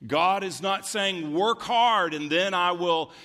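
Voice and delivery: high, loud preaching; preaching at full force. Acted. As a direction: build to a ringing climax. Preaching climax.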